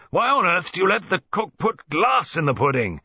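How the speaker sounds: loud, high, furious complaint; roaring. A narrator's reading. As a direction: roar at his wife about the glass. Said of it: "he roared".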